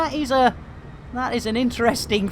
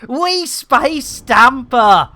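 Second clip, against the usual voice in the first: much higher, louder triumphant yell; gleeful shouting; 10 semitones higher, 8 dB louder, slower than usual. Real, not acted. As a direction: yell with glee and laughter. Gleefully spacing Stamper.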